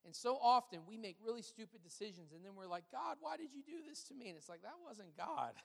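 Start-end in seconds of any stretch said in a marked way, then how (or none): 2.9-4.3 higher-pitched whiny plea to God; put-on victim's lament; mock complaint. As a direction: imitate someone blaming God, voice lifted high. Mimics people blaming God.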